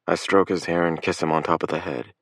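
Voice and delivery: plain reading voice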